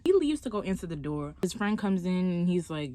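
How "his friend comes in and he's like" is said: ordinary talk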